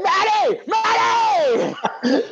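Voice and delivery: high called-out name; imagined high shout of a friend's name; calling out. Retold or imitated. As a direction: call to a friend across the room. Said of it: "Be like".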